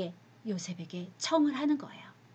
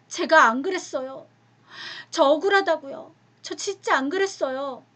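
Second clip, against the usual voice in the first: wronged pleading; 6 semitones higher, 6 dB louder than usual. Acted. Joseph pleads his innocence.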